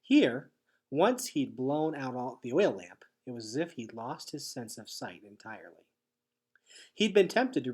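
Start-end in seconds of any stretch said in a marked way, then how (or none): none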